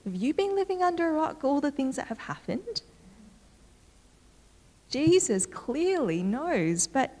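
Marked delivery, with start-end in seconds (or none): 0.0-2.8 incredulous, quick and rising, a lightly played surprise; mock disbelief. Disciples asking Jesus where he's been, in mild mock disbelief. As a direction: play the disciples, amazed he hasn't heard. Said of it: "it's like".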